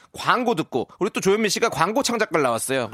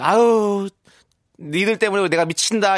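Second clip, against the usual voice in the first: louder, affectionate fed-up cry; fond exasperation; 4 semitones higher, 6 dB louder, slower than usual. Pretend. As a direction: scold them fondly, out loud. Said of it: "미라클 여러분들이". (애정 어린 투정)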